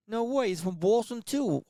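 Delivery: put-on slow mumbled Boston accent, pitched high; Boston accent like marbles in the mouth. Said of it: "like, marbles in my mouth".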